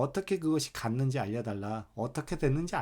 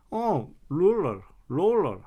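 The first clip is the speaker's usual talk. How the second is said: slow, louder mock teacher saying "롤러"; imitating the teacher; 3 semitones higher, 6 dB louder, slower than usual. Student imitating the English teacher, slow and louder. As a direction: drag out "롤러" like a know-it-all teacher. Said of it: "롤러를 뭐 이렇게 얘기해놓고".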